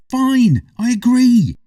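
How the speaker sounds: put-on loud, high grudging boy; grudging shout. A narrator's reading. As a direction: yell it out, defeated.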